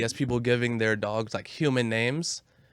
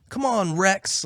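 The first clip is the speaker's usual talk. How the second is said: mock dog owner calling; 7 semitones higher, much slower than usual.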